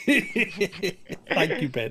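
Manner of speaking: repeating the thanks on request, laughing. Real, not acted. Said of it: "I wanna hear it again".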